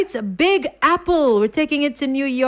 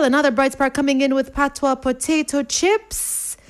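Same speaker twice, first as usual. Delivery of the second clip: normal talking voice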